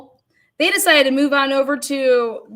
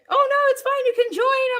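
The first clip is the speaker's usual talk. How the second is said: cheery invite; 13 semitones higher than usual. Retold or imitated. Strangers inviting her to join.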